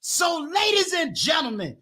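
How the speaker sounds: big high-pitched MC build-up, stretching key words; hype announcer introduction. Acted. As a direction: bring the guest on stage like a showman.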